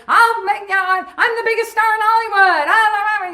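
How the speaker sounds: high, loud star tantrum; mock scream. Tony Clifton screaming as guards drag him out, high loud. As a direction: rant while being dragged out. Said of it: "screaming and screaming".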